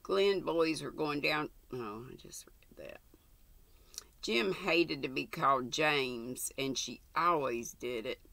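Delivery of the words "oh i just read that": soft unvoiced aside to herself; whispered aside. Real, not acted. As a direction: mutter the slip under the breath. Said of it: "reader's whispered aside".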